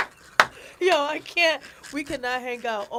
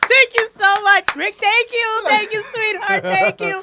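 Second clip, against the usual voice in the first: higher, louder grateful thanks; excited thanks; 7 semitones higher, 6 dB louder, slower than usual. Real, not acted. Guest gushes thanks to host Rick, excited. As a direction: gush the thanks out.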